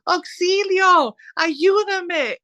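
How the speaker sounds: high, drawn-out mock cry for help; retold Spanish scream. Sibling imitates brother screaming in Spanish from the water, high and drawn out. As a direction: stretch out a high-pitched imitation yell. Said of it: "And then he's like".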